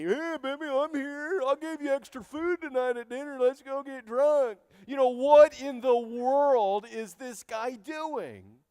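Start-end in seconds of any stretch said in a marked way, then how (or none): 0.0-4.6 high, crooning husband voice; mock smooth-talking husband. Elkanah wooing his sad wife, high and crooning. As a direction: croon it like a sleazy sweet-talker. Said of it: "your mistress doesn't like me".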